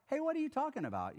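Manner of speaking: quick, high, nosy question; pushy stranger. Retold or imitated. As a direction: pop in fast and curious. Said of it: "just said".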